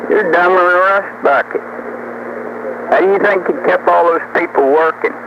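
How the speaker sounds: usual speaking voice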